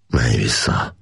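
officer gently ordering the tiger back. A narrator's reading. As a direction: send the tiger back kindly. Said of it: "mondta gyöngéden".

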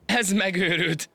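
very high and drawn out; amused disbelief. A narrator's reading. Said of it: "teli torokból nevetni kezdett". (magas hangon, elnyújtva csúfolódva)

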